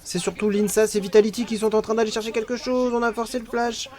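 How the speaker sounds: fast, excited play-by-play; esports caster. Real, not acted. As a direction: call the fight live.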